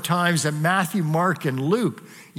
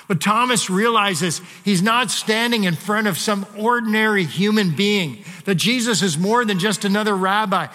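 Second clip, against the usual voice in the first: usual speaking voice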